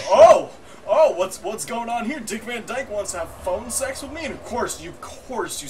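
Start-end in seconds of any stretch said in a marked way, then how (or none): 0.1-4.2 higher, startled reaction with a loud start; mock surprise. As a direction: play the taken-aback phone listener. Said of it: "and you're like".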